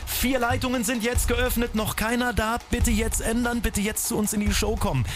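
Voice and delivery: high-pitched, insistent call to act; urgent appeal. Real, not acted. Host begs listeners to call the open lines, urgent appeal. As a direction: press the listeners to ring now, pitched high.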